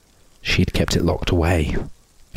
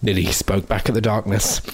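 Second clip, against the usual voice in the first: ordinary delivery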